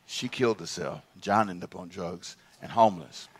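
low, hushed, halting recollection; subdued, grave and quiet; somber. Real, not acted. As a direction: tell it softly, with long heavy pauses. Recalls a friend's suicide.

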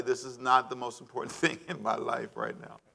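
soft, amused aside with a chuckle; laughing. Shown as a demonstration. Preacher laughing softly at how hard it is to say.

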